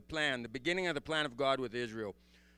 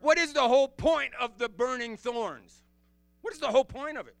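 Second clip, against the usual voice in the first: louder, higher, driving the question home; preaching loud; 7 semitones higher, 7 dB louder than usual. Real, not acted. Preacher challenging his congregation, loud and high. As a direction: demand the answer from the room.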